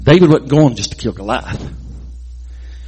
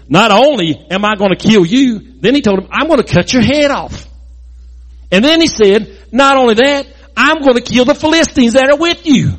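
escalating boast; 8 semitones higher than usual. Retold or imitated. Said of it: "David took it up a notch".

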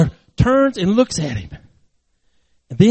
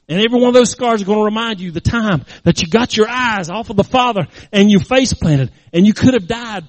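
raised, emphatic preacher voice; forceful preaching; 4 semitones higher, 6 dB louder than usual. Real, not acted. Preacher warning the congregation about face planting, forceful. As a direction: drive it home to the congregation.

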